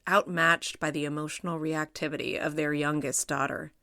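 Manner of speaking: ordinary delivery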